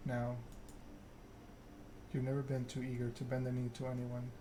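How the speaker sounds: usual speaking voice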